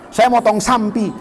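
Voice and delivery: "sampi" with an added "m" before "p"; Jakarta "sampi". Retold or imitated. Jakarta student answering about the sacrifice, "sampi" with an added "m". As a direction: slip a nasal "m" into "sampi". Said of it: "mesti sampi".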